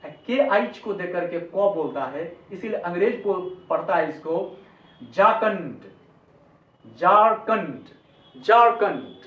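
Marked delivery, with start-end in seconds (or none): 5.1-9.1 झारखंड said unaspirated as "jaar-kund", falling; झारखंड with plain j and k, no puffs; English pronunciation. Shown as a demonstration. Teacher models how English speakers say it, झारखंड as "jaar-kund". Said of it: "ऐसे वो लोग बोलता है झारखंड".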